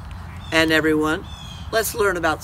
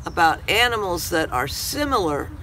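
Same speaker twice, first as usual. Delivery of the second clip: ordinary delivery, nothing marked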